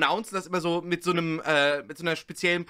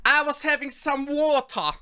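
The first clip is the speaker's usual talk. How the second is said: higher, put-on British voice; imitation British English, pitched up; mock British accent; 7 semitones higher than usual. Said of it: "Water in British accent".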